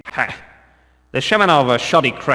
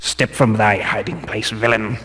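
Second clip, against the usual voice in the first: ordinary talk, nothing marked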